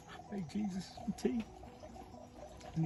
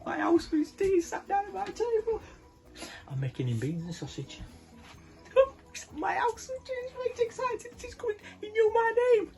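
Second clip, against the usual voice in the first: very high, squeaky delight; bursting with glee; giddy excitement; 7 dB louder than usual. Acted. Zacchaeus giddy that Jesus comes for tea, squealing.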